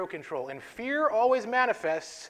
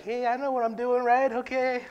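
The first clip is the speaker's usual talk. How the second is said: higher, bluffing confidence; mock self-assured teen; 7 semitones higher, 5 dB louder than usual. Teen boy on his first flight, faking confidence, higher voice. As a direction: fake it like you know the drill.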